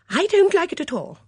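put-on high and forceful, old lady putting her foot down; with sudden vigour. Dora insists to Miss Blacklock, suddenly loud and high. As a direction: burst out firmly. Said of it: "with unsuspected vigour".

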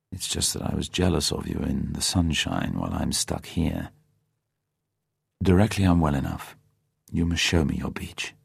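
soften, say sorry kindly; relenting gently. A narrator's reading. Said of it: "Bond at once relented".

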